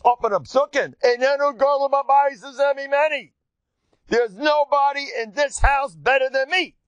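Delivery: high, proud declaiming; a strutting braggart announcing himself; boastful proclamation. Retold or imitated. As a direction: puff up and proclaim your greatness.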